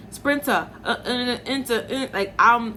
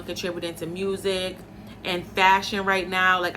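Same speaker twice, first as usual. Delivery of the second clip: usual speaking voice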